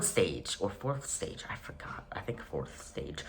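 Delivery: whispered to himself; whispering. Real, not acted. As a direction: whisper the aside. Whispered aside.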